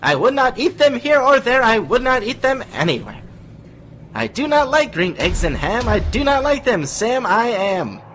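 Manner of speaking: high silly voice. Acted. Guest switching impressions while reading to listeners, high and loud. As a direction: squeal it out bright and loud. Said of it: "impression while reading".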